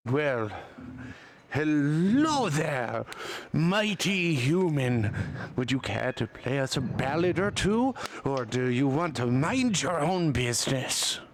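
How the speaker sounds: confrontational golem with swooping pitch. Acted. Golem shopkeeper tells a human to mind their business, confrontational golem voice. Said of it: "he's supposed to be hard to understand".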